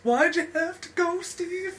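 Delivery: hammed-up grief, pleading high; mock lament. Mock lament for Steve Jobs.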